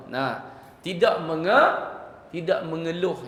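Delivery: ordinary talk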